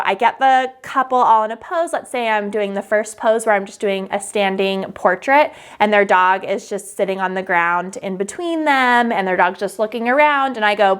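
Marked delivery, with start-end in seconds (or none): none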